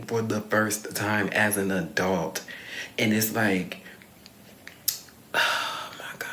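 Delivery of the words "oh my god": exasperated sigh. Real, not acted. Exasperated sigh after sheltering rant.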